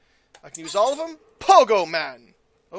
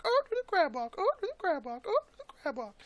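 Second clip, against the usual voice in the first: silly put-on voice; 9 semitones higher, 7 dB quieter, faster than usual. Repeats phrase in silly voice.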